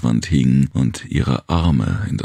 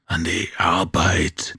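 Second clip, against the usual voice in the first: slow, hissing creature roar; hissing guttural voice; 4 semitones higher, 3 dB quieter, slower than usual. Acted. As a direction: bellow it in a guttural hiss. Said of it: "brüllte er mit zischender, kehliger Stimme".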